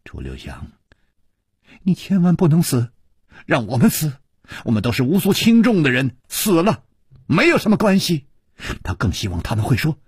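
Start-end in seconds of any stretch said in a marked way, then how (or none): 0.0-8.3 imagined sacrifice. Retold or imitated.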